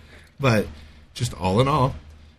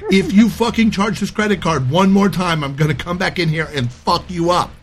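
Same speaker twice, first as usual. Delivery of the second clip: louder, higher repeated threat; furious warning, pitched up; angry threat; 7 semitones higher, 5 dB louder than usual. Retold or imitated. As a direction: lean into the threat hard.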